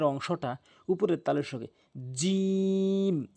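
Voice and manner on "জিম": জিম drawn out very slowly with the middle of the tongue on the palate; letter demonstration. Shown as a demonstration. Teacher demonstrating the letter for students, very drawn out. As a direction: stretch জিম long and slow. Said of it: "এভাবে".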